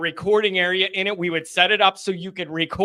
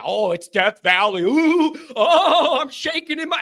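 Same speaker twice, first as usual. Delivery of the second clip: higher mock-frightened whining; mock the fans' fright, hammed-up; mock scared LSU fan; 6 semitones higher than usual. Host mocking LSU fans' Death Valley boasts, mock-scared. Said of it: "sick of hearing it".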